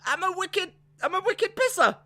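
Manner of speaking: high-pitched mock Bostonian; mock Boston accent. Trying out the Boston phrase.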